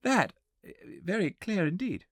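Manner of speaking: lightly mocking young guest; young man relighting cigar. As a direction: mumble praise while puffing a cigar. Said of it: "making spasmodic efforts to relight his cigar".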